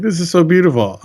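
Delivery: overjoyed and a little high; excited. Retold or imitated. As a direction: gush with delight. Joyful hug from orphan kids.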